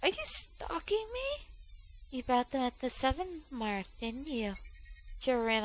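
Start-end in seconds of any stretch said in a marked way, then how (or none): 0.0-1.5 lightly voiced high girl, slow and probing; a hint of a menacing schoolgirl; eerie girl voice. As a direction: ask it like a creepy teasing girl. Girl confronts him, high voice.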